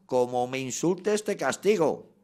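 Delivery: put-on slow, loud, rising threat to a child; fed-up threat. Exasperated parent threatening a child, loud slow threat. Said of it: "¿qué decimos?".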